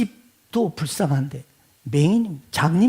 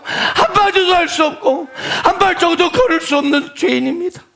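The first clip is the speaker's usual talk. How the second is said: loud, high fervent prayer; crying-out prayer; 11 semitones higher, 3 dB louder than usual. Shown as a demonstration. Preacher modeling the congregation's crying prayer, loud fervent plea. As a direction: pour it out at full preaching force.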